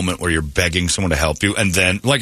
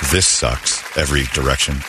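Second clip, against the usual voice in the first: lower, dragging misery; low defeated groan; 6 semitones lower than usual. Retold or imitated. Exhausted man at bedtime, a low defeated groan. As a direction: sigh it out, beaten.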